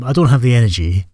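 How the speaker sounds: ordinary talk, nothing marked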